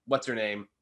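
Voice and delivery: ordinary delivery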